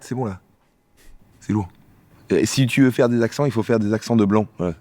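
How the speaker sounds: normal talking voice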